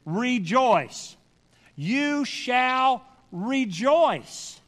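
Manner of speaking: slow, spaced words with REJOICE hit hard; stress on REJOICE. Real, not acted. Preacher has them underline this word, emphatic REJOICE. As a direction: punch the second syllable of REJOICE and let it fall.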